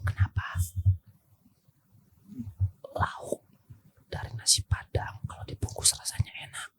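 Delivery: soft whispered riddle; whispering. Real, not acted. Whispered riddle, then asked to repeat.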